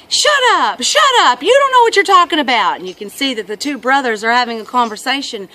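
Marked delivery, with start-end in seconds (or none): 0.1-2.8 put-on high, yelled kid retort; loud, high-pitched sibling outburst; boy snapping back. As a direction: imitate a child hollering at his brother. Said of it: "little boy leaning out the window, he's like".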